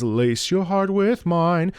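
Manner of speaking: slow sung line, weight on "mine"; stress on last word. Shown as a demonstration. Vocal coach emphasizes the last word "mine". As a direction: land the phrase on "mine". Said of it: "the emphasis at the last word".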